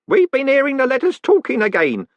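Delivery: put-on high-pitched old postman, insistent; old Groat, insistent. A narrator's reading. Groat telling them about the letters, insistent. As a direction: confide it urgently in a high voice.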